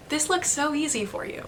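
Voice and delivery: softly and at ease, the professor's calm reassurance; calm, soft encouragement. Retold or imitated. Professor calmly reassures struggling cello student, soft and easy. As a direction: gently tell the student it looks easy. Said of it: "he had this very calm demeanor".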